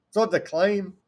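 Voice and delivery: normal talking voice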